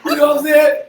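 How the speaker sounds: loud, laughing hard through the words; cracking up at his own punchline, laughing loudly; laughing shout. Real, not acted.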